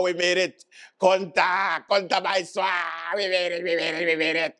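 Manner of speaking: high, strained cackling caricature; hammed-up gloating politician voice; gloating future Prime Minister. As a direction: crow in triumph with stretched words and mad laughter.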